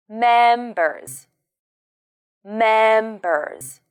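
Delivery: "Members" ending in a buzzing z; voiced z at the end, not s; final Z sound. Shown as a demonstration. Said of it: "remember to use the final Z sound".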